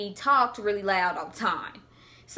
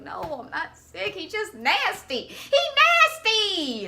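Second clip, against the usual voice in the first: rising, much higher, stretched-out "He nasty"; indignant denial; 9 semitones higher, 3 dB louder than usual. Retold or imitated. Telling the teacher she isn't sick, indignant denial.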